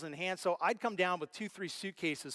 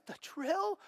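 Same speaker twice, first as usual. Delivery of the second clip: stunned disbelief; 12 semitones higher than usual. Retold or imitated. The crying pastor asking if the drill is his, astonished. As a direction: ask it as if you can't believe it.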